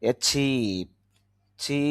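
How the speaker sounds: অ্যাচিভ with a drawn-out "chee"; long ee vowel. Shown as a demonstration. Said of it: "টান হবে". (অ্যাচিভ-এর চি টেনে উচ্চারণ)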